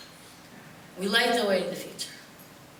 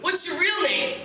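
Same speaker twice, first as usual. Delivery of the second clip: much higher, slow mocking demand; suspicious locals; 8 semitones higher than usual. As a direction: press for the real name. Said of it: "they're like".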